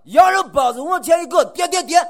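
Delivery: Shaanxi vendor's shout. Retold or imitated. Showing the Shaanxi bun seller's cry, loud and high. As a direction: bark out the price and knocks. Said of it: "我吆喝出来你就知道了".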